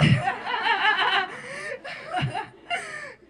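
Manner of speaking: long loud burst of real laughter. Real, not acted. Speaker recalling the judge's joke, laughing out loud. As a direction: crack up at the memory.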